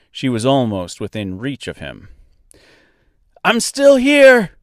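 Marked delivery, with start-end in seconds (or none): 3.4-4.5 slow, high, loud yell; yelling over noise. A narrator's reading. Dorothy to Mister over the river noise, yelling. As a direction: stretch out a shout to carry over the river. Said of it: "she yelled over the noise of the river".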